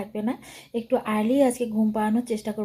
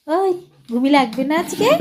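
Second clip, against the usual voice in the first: higher, louder call to a little one; 4 semitones higher, 10 dB louder than usual. Real, not acted. Mother calls to playing child.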